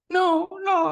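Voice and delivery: put-on high, laughing little-girl refusal; imitating his giggling daughter; daughter's voice. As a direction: squeal a giggly no like a six-year-old. Dad retells daughter refusing chicken.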